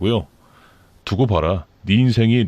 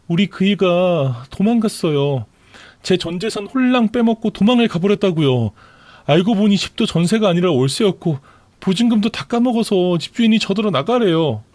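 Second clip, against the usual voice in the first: wailing mistress; 8 semitones higher than usual. Acted. Pregnant mistress complaining to sister-in-law, higher whiny wail. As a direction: pour out your misfortune in a raised, pleading voice. Mistress wails about husband.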